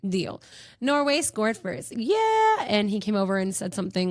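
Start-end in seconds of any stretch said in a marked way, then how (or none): 2.0-2.7 high, loud drawn-out cheer. Retold or imitated. Fan sees Norway score first, cheering. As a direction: let out a squealed victory yell.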